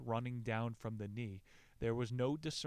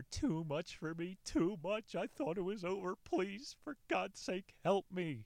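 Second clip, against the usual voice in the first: desperate pleading; 8 semitones higher than usual. Acted. Dying climber begging for help.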